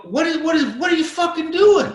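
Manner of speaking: high-pitched, incredulous mock shout; shocked demand. Walking in on him on the toilet, shocked. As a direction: demand an explanation, voice shooting way up.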